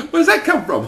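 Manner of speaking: high, loud and laughing; mock him while cracking up; laughing mockery. Real, not acted. Mocking the skinhead to the interviewer, laughing high and loud.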